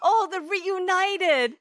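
high, slow gushing coo; gushing over the tiger. Acted. Friend gushing about a tiger reunion video, high, slow and cooing.

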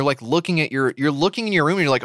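ordinary talk, nothing marked

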